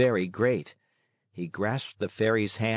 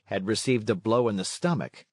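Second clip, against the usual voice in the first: plain reading voice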